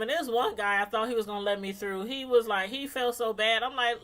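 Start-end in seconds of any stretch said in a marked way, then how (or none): none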